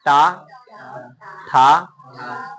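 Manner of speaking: ठा डा ढा said loud and apart, tongue curled back; demonstrating retroflex sounds. Shown as a demonstration. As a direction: bark out ठा, डा, ढा. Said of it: "टा, ठा जब बोल रहे हैं ना".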